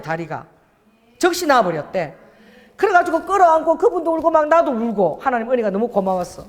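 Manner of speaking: usual speaking voice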